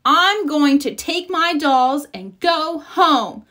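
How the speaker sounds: a touch of a sulk; huffy. A narrator's reading. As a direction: stomp away offended. Sister storming off angry.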